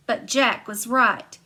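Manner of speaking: usual speaking voice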